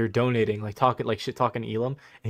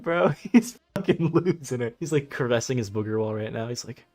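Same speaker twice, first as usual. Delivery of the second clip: ordinary delivery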